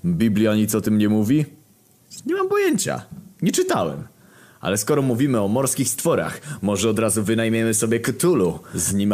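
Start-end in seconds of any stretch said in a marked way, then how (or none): 2.3-4.0 high squeaky voice for a character. Acted. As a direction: pitch it way up for the character. Wysoki głos postaci. (wysoki, piskliwy głos postaci)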